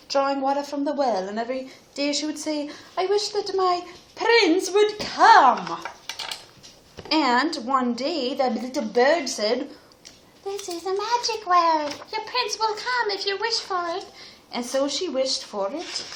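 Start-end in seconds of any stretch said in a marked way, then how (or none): 3.0-5.8 put-on higher, slow storybook girl; wishful girl. Girl wishing in the tale. 10.4-14.0 high little bird voice. Acted. Little bird speaks in story.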